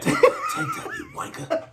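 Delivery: squeaky high laughing taunt; giggling high-pitched jeer; laughing mock voice. Mimicking Braille message with laugh.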